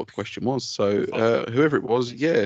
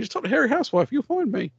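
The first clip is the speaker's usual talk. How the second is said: high squeaky plug; 11 semitones higher than usual. Acted.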